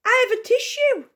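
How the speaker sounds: high, loud fussing mum; eager exclamation. A narrator's reading. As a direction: rush in to help. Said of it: "exclaimed Davish's mum".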